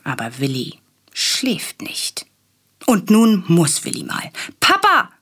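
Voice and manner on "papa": put-on high, loud call of a small boy; child calling out. A narrator's reading. Willi calling Papa back from bed, high and loud. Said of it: "ruft Willi".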